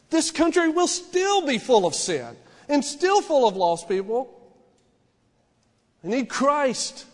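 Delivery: loud, high, full-force sermon delivery; fiery preaching. Acted. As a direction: preach it to the congregation with fire.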